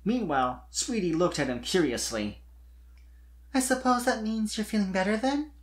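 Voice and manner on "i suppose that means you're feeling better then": put-on young mare's voice, higher, asking shyly; weak, timid ask. A narrator's reading. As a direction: ask it tentatively, half afraid of the answer. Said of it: "she asked weakly".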